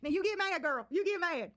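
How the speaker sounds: high teasing jeer; mock her anger in a high sing-song; mocking taunt. Real, not acted. Taunting streamer.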